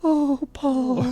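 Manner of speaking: high-pitched imitation of a smitten woman; put-on adoring fan voice; mock swooning. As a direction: coo it in falsetto. Imitating Annie's swooning reply.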